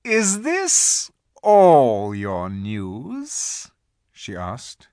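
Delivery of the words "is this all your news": very slow, loud witch; cruel smile. A narrator's reading. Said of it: "A slow, cruel smile".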